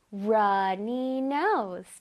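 very slow, careful English; slow teacher demo; slow model reading. Shown as a demonstration. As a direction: read each word slowly for students. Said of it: "시작".

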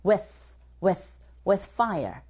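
with said with only the mouth shaped for the TH; clipped with, tongue set for TH but unvoiced; soft with. Shown as a demonstration. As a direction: barely sound the end of with. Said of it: "그냥 입만 잡아주시면 돼요".

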